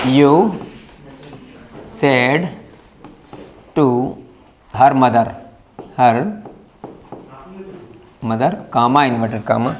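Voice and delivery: dictating word by word with long pauses; slow dictation. Shown as a demonstration. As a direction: spell it out slowly for the class. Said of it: "यहाँ लिखते हैं". (धीमे-धीमे शब्द-दर-शब्द बोलकर लिखवाना)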